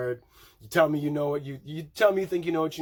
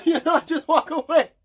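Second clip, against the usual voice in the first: high and loud through a laugh; giggling advice; 11 semitones higher, 6 dB louder than usual. Real, not acted. Laughing while advising.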